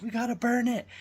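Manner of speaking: high, loud urgent plea; grossed-out players. Retold or imitated. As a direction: demand it be burned. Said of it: "they're like".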